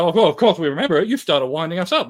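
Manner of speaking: fast, high, loud comeback; retold annoyed reply; exasperated retort. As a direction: fire back as if fed up. Band retorts about old argument.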